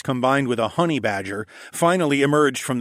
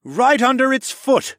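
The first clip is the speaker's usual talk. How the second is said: high, loud realisation; excited completion; 13 semitones higher, 6 dB louder than usual. A narrator's reading. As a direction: finish your partner's plan excitedly. Completes plan amid battle.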